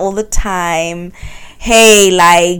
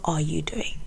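how are you doing, whispered; whispered question; 3 semitones lower, 13 dB quieter than usual. Real, not acted. Host gently asking listeners how they are, whispered. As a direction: breathe the question softly.

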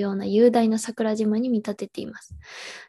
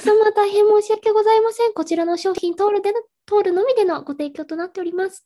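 high, formal apologetic clerk; overly polite store staff voice; customer service voice; 10 semitones higher, 4 dB louder than usual. Retold or imitated. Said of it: "って言われると思う".

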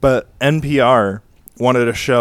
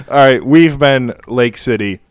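ordinary talk, nothing marked

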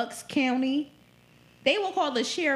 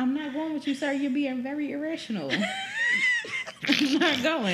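laughing mock refusal; 5 semitones higher than usual. Retelling refusal to officer, laughing.

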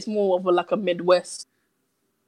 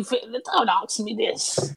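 drawn out, half the usual speed, put-on voice; slow mock plea; 5 dB quieter, much slower than usual. Begging off the Anglophile question, slowly and playfully. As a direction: stretch it out like a playful groan. Repeated deflecting a word question.

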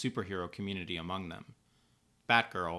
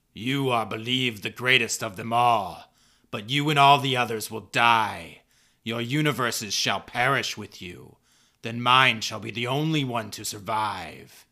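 loud, slow booming villain declaration; menacing villain; 3 semitones higher, 10 dB louder than usual. Acted. Anti-Monitor threatens battered Superman, loud villainous menace. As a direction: pronounce their doom slowly and loudly.

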